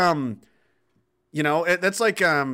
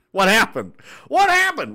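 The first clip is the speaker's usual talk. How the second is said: loud, jumping higher on the repeat; mock deaf shout; 7 semitones higher, 6 dB louder than usual. Joking that ears are ringing, mock deaf shout.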